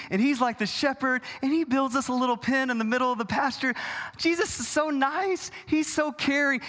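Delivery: high, sweetly gushing mock believer. Churchgoer thinking fondly of the caring shepherd, mock-gushing.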